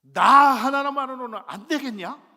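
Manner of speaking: God calling out to the whining pastor, booming; God's booming voice. Retold or imitated. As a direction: thunder it down from heaven. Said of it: "라고 말씀하시면".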